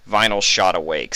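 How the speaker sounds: plain reading voice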